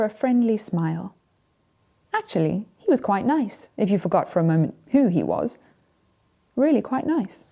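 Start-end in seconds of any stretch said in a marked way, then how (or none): none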